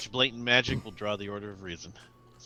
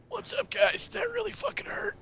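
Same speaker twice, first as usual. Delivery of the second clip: much higher, strained comic voice; dazed Ethan voice. Acted. GM voicing Ethan after the blast, high and dazed. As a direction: squeak it out like a hurt, bewildered bird. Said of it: "Ethan's like".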